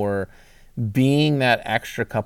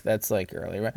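hushed, breathy aside; whispering; 4 semitones lower, 8 dB quieter than usual. Retold or imitated. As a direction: drop to a whisper. Whispered aside in retold thought.

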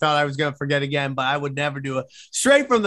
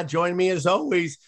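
ordinary talk

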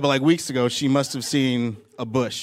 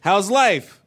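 calling out; 11 semitones higher than usual. Real, not acted. Comic calling out to crowd.

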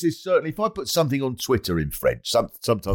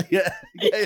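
laughing yeah; faster than usual. Real, not acted.

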